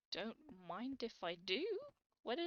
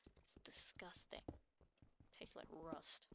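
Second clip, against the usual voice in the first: disgusted whisper; 5 semitones lower, 9 dB quieter, slower than usual. Acted. Mock disgust at iron.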